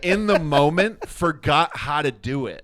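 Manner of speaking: high, loud, incredulous outburst; loud, high-pitched amazed confession; amused disbelief. Real, not acted. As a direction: exclaim it loudly, cracking up at your own blunder. Cracking up at failed accent.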